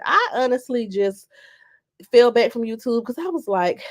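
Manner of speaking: ordinary talk, nothing marked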